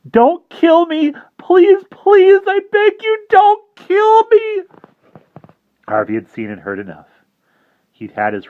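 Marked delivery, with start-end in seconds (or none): 0.1-4.6 high, loud desperate pleading, slow; begging for mercy. Acted. Kneeling boy begs the vampire for mercy, shrill. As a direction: grovel for your life. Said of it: "begging for mercy".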